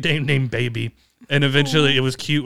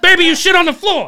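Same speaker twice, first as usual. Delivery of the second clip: yelling; 7 dB louder than usual. Retold or imitated. Yelling at the dog.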